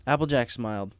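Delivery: plain reading voice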